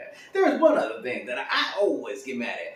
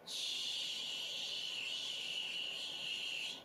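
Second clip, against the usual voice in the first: long, soft sustained shh; imitated running water; 16 dB quieter than usual.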